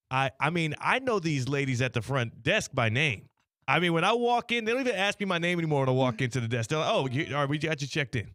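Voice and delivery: ordinary delivery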